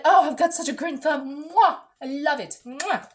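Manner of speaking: happy gushing. Pretend. Imagining being a happy plant owner, playful gushing. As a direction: gush proudly with kiss noises, hammed up. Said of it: "I'd be so happy".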